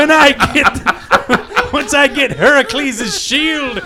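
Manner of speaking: grandiose flea circus showman. Retold or imitated. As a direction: announce the dream like a showman. Mock villain plans flea circus.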